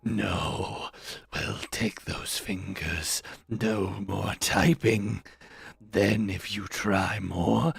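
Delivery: low, slow, sinister overlord; low slow villain voice speaking as YouTube; menacing YouTube voice. Acted. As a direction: purr the threats like a villain toying with prey. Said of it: "where YouTube was saying".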